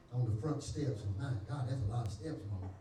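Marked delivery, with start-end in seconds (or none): none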